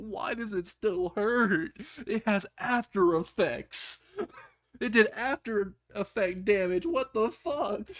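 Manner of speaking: high, slow, pained whine; mock-suffering high moan; pained whining. A narrator's reading. Beaten character complaining of lasting pain.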